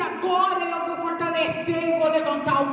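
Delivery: high, driving sermon delivery; full-voiced sermon cry; impassioned preaching. Real, not acted. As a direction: preach with rising fervour, pitch held high.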